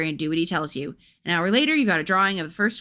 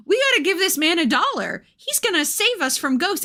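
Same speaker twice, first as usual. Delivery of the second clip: high excited put-on voice; 10 semitones higher than usual. Reader cheers on giving dollar.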